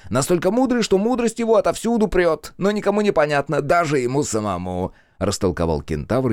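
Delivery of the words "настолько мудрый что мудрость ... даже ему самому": high, teasing explanation; snide smile. A narrator's reading. Centaur explains the mocking new word, snide. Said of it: "ехидно улыбаясь".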